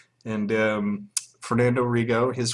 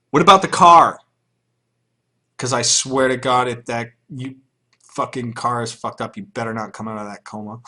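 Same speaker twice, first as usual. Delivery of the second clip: loud outburst fading to a threat; mock angry caller; 2 semitones higher, 9 dB louder than usual. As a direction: bark it at the patient, then settle.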